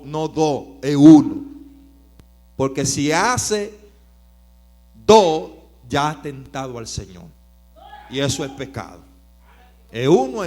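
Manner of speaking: preacher proclaiming to the congregation; full force preaching. Acted. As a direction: preach it with fire.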